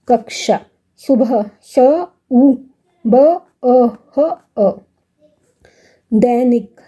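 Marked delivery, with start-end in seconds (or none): 1.6-4.8 स, उ, ब, अ, ह, अ as isolated sounds; sounded out separately. Shown as a demonstration. Teacher breaking सुबह into sounds, sounded out separately. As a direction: spell out स, उ, ब, अ, ह, अ with a gap after each. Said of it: "हमने अलग-अलग कर दिया".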